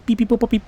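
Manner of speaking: string of high beeps and boops; speaking computer language. Pretend. Player talking to the computer to hack it, high beeping. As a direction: bleep away like a chattering computer. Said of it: "You gotta speak the computer's language".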